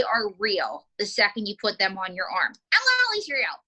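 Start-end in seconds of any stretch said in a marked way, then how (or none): 2.7-3.5 high-pitched puppet interjection; May. Acted. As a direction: pipe up indignantly as a puppet. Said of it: "We know that, May".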